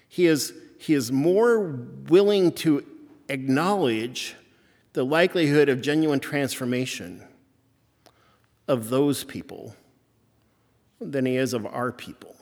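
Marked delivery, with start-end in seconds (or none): none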